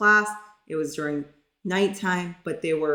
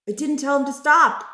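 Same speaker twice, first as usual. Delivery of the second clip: higher and louder, aghast at herself; sudden loud realization she never said stop; dismayed outburst; 8 semitones higher, 5 dB louder than usual. Real, not acted. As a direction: repeat it again, now loud and upset.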